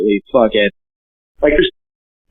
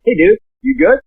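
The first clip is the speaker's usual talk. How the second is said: higher mock-concerned friend voice; imitating worried friend; 9 semitones higher than usual. Friends texting after the news, higher mock-concerned check-in. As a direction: ask it like a worried buddy, lightly played. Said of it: "And they're like".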